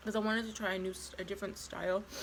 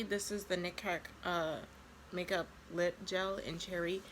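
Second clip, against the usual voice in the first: ordinary talk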